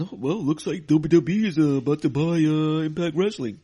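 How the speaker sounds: loud, high gossiping fan voice; mocking fans. Mocking social media fans spreading buyout rumors, loud and high. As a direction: ham up the know-it-all rumor. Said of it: "took to social media saying".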